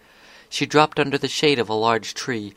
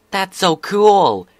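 high, loud, drawn-out COOL; excited exclamation; 8 semitones higher, 10 dB louder, much slower than usual. Acted. Scootaloo reacting to her friend's news, exclaiming. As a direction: gush loudly, stretching it out. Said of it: "Scootaloo finally managed".

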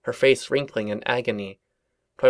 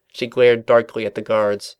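plain reading voice

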